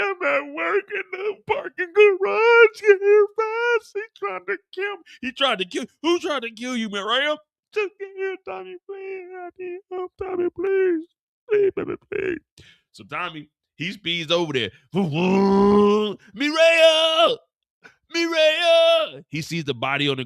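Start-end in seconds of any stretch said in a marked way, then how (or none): 0.0-12.4 pleading woman. Acted. Mireya begging Tommy to come. 14.9-19.2 high, loud calling out. Acted. Tommy calling out arriving.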